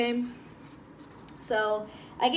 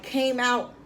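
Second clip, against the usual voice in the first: ordinary talk, nothing marked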